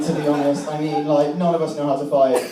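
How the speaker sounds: normal talking voice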